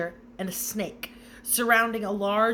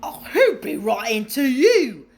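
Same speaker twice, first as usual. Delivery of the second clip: loud, scornful and higher sneer; 5 semitones higher, 10 dB louder than usual. A narrator's reading. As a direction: look down on the boy as you say it. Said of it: "sneered Uncle Vernon".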